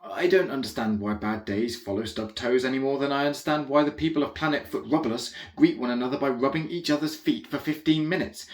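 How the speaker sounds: plain reading voice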